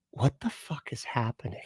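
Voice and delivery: whispered in retold disbelief; soft whispered imitation of his own confusion; stunned whisper. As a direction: breathe it out under your breath, baffled. Retelling first watching the movie.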